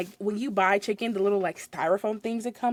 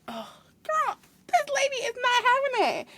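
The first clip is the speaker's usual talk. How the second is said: sassy; 13 semitones higher than usual. Retold or imitated. Manager sassy aside.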